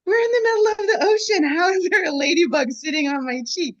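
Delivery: can't believe it, rushing, high and loud; incredulous surprise. Retold or imitated. At sea with a ladybug on her cheek, high, loud and amazed. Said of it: "And I'm like".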